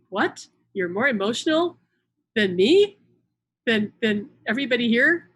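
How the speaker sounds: loud, disbelieving question; surprised. Retold or imitated.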